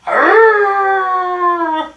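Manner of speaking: loud, high, drawn-out howl; angry dog howl. A narrator's reading. Dog howls to the moon at night, angry howl. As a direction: howl long and angrily at the moon. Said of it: "Dog howling to the moon".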